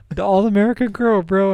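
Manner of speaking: high, loud mock wail; Pat Cap. Storyteller imitating crying friend at strip club, mock wail. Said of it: "crying slowly as he's like".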